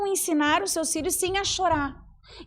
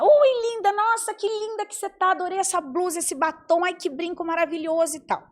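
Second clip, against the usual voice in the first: gushing compliments; 4 semitones higher than usual. Retold or imitated. Woman complimenting friend Tupi to her face, gushing. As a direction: lay the flattery on thick. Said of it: "vou falar com ela".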